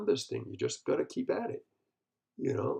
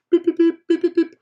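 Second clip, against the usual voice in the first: high, loud sung beeps like a news intro; mock news jingle beeps; 13 dB louder than usual. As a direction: beep out a broadcast jingle, much higher. Mocking a news bulletin jingle.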